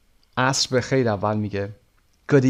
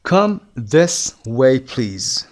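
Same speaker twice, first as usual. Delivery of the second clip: slow demo; 4 dB louder, much slower than usual. Shown as a demonstration. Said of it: "teacher drills the dialogue line".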